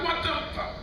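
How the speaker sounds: high shocked squeal from the wife. Retold or imitated. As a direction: squeal it in disbelief.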